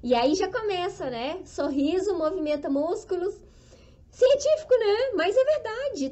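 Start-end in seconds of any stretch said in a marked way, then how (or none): 4.1-6.1 emphatic exclamation. Real, not acted. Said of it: "emphatic aside about science".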